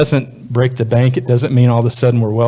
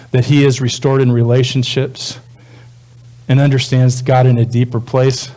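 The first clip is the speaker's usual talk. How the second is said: normal talking voice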